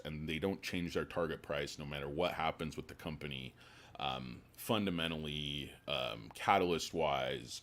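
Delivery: usual speaking voice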